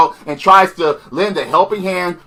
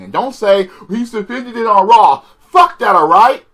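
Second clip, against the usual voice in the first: angry rant at commenters; 5 semitones higher than usual. Real, not acted. Vlogger scolding viewers, angry and raised. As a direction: snap at the comment section, pitch up hard.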